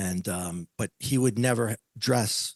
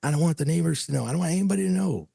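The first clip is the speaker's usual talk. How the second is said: urgent pleading; 6 semitones higher than usual. Retold or imitated. Pleading with wife, retold.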